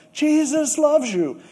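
high, loud, drawn-out yell; a big retold yell; yelled into a mailbox. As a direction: shout it into the mailbox. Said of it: "stuck his head in the mailbox and yelled".

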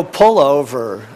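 husband ordering his wife to stop the car; firm order. Retold or imitated. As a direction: lay down the law, slowly and loudly.

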